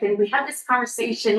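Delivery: ordinary delivery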